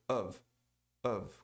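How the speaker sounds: Of reduced and quick, a short schwa then V; weak short of. Shown as a demonstration. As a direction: clip Of down to its weak form. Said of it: "e mais curtinha". (forma fraca e curtinha de Of)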